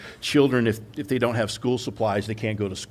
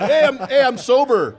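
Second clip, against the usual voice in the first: high, loud mock announcement; mock calling out; 13 semitones higher, 6 dB louder than usual. Joking driver calling to police, mock calling out. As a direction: call out as if flagging someone down. Acted boast to passing police.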